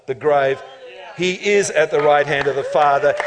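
ordinary talk, nothing marked

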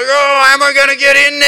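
high, loud exasperated wail; frustrated Joshua. Retold or imitated. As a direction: throw up your hands like a beaten general. Frustrated Joshua kicking helmets.

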